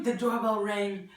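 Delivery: plain reading voice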